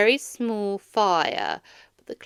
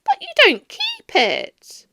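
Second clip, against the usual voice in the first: protesting nephew; 9 dB louder than usual. A narrator's reading.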